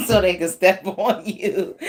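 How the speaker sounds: words broken up by real giggles; laughing. Real, not acted. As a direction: try to finish while laughing. Laughing while giving the reading.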